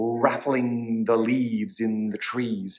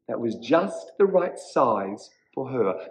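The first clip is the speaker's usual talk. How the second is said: plain reading voice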